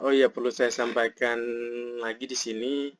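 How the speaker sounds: usual speaking voice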